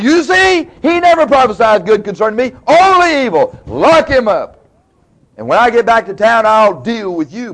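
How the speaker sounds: high, loud mock-royal rage; angry outburst. King orders the prophet locked up, angry outburst. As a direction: rail indignantly as an acted furious king.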